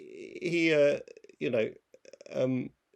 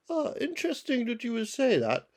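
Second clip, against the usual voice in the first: higher-pitched posh comic-strip gent; posh pugilist; 6 semitones higher than usual. Acted. Friend imitates Viz character starting a fight, posh politeness. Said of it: "the posh guy who always tries to start a fight".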